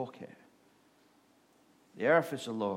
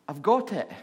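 loud, higher excited shout from the street; man shouting up; 6 semitones higher, 9 dB louder than usual. Retold or imitated. Man below the window shouts up to the nun, loud and excited. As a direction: call it up to the window, thrilled.

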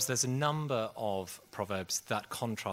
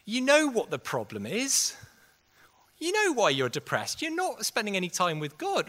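higher, loud, blunt telling-off; blunt rebuke; 11 semitones higher, 7 dB louder than usual. Retold or imitated. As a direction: shake him out of complacency with a shout. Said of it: "He just went".

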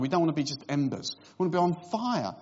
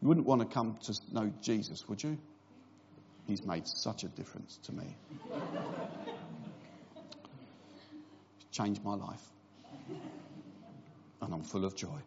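much lower, much softer joyless mock testimony; 7 semitones lower, 11 dB quieter than usual. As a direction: sell joy with none in the voice. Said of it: "we don't seem that excited".

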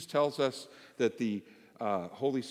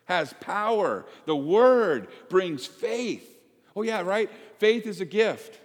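high, loud preaching at full force; fervent preaching; 10 semitones higher, 10 dB louder than usual. Real, not acted. Pastor urging the congregation, preaching high and loud. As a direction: lift the room with the Word.

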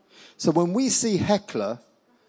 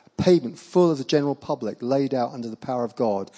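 usual speaking voice

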